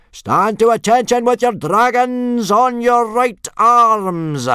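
very high, drawn-out parade command; yell. A narrator's reading. Instructor ordering boys to attention, yelling. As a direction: roar the order, stretching it out. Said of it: "yelled Gobber the Belch".

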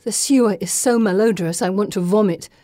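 ordinary delivery

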